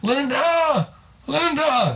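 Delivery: high, drawn-out call for his wife; storyteller's high-pitched acted holler. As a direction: holler out to someone far off. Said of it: "he's hollering".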